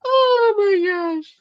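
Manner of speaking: high, breaking laugh-cries; helpless real laughter, tears streaming; slow, high squeal of laughing till it hurts; crying with laughter. Real, not acted. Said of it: "I'm crying".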